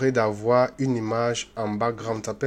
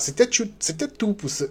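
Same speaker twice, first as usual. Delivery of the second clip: stumbling over words; 10 semitones higher than usual. Real, not acted. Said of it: "ah, je bafouille".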